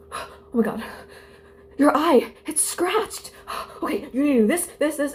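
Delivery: high-pitched mock panic with gasps; freaking-out nurse. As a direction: imitate a nurse panicking over an injury. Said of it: "she was freaking out".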